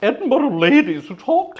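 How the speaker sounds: lightly put-on posh Edinburgh lady; a hint of an over-the-top old Edinburgh accent; mock posh Edinburgh voice. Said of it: "very over-the-top accent".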